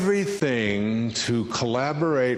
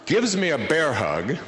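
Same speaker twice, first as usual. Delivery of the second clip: normal talking voice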